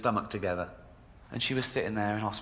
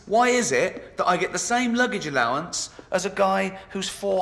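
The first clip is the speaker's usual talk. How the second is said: indignant rant; 7 semitones higher than usual. Real, not acted. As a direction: build the outrage. Rising rant on luggage.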